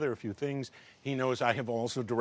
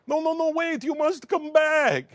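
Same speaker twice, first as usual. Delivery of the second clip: put-on much higher, loud, slow foreign accent; Tommy's odd accent, pleading; 6 dB louder than usual. Tommy begging the director not to leave, in a high strange accent. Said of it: "with the craziest accent that you have ever heard".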